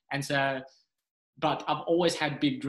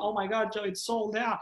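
higher-pitched imitation of an excited sister; mildly mock astonished sister; amazed sister; 7 semitones higher than usual. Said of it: "my sister's got this look in her face and she's like".

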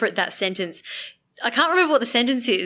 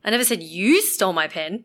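"you" punched, ending on a rise; stress on YOU. Shown as a demonstration. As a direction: hit "you" as the surprising word. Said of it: "put the emphasis on each".

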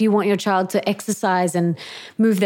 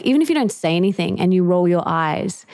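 ordinary talk, nothing marked

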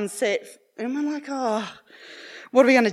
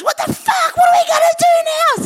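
much higher, loud furious outburst, fast; impatient husband yelling; 9 dB louder, faster than usual. Retold or imitated. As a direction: explode at the dead end. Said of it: "my husband's a very impatient man".

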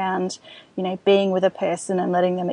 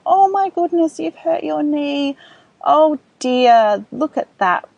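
put-on higher, gently concerned, matching the child; caring parent voice; 7 semitones higher, 4 dB louder, slower than usual. Shown as a demonstration. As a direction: soothe a hurt toddler, concerned but calm. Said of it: "we'll use that kind of language to demonstrate".

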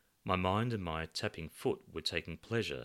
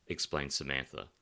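usual speaking voice

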